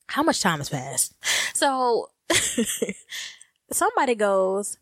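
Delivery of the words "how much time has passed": lower, settled delivery; low, matter-of-fact repeat; dropped-down question. Retold or imitated. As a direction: ask it low, without stretching it. Same question repeated more plainly.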